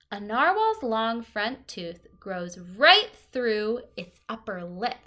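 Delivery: lively picture-book read-aloud; reading to children. Acted. Teacher reading a book to the class, lively and clear.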